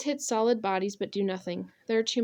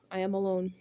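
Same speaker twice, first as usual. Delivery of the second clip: ordinary delivery, nothing marked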